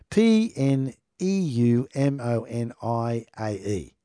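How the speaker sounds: P N E U M O N I A E spelled slowly letter by letter. Shown as a demonstration. Spelling the bacteria name slowly for viewers. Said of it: "but I'll just spell it".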